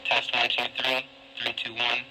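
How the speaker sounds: ordinary delivery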